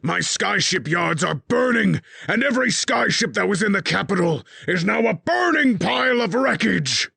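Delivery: high, loud raging tirade; furious king. A narrator's reading. King rants at his spy master over the burning city, raging shout.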